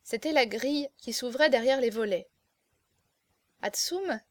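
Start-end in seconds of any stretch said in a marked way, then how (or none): 3.6-4.2 hushed, higher-pitched, anxious questioning; gentle trembling woman. A narrator's reading. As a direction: call out timidly in a gentle woman's voice. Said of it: "une voix douce de femme qui tremblait".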